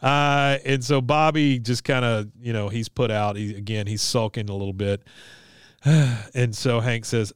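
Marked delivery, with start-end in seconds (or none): none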